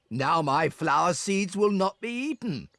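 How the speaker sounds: normal talking voice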